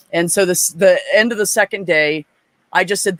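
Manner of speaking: normal talking voice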